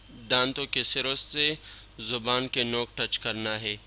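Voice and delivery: ordinary talk, nothing marked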